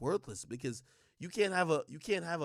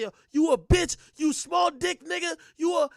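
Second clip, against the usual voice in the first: loud, high-pitched imitation of a tirade; mock angry insults; 10 semitones higher, 8 dB louder than usual. Women going off on a man, mock angry. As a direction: hurl the insults in mimicry.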